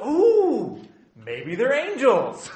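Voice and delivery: high, loud hammed-up "Ooh", drawn out; mock awe. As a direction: gush it in exaggerated, wide-eyed wonder. Mocks a gullible awed reaction.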